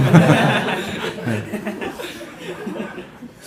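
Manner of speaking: long rising then falling laugh; real amused laugh; high laugh. Real, not acted. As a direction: laugh openly at the memory. Laughing at the story.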